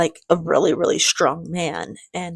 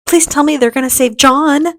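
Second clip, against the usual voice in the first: pleading; 8 semitones higher, 8 dB louder than usual. Real, not acted. Moved by the song, begging.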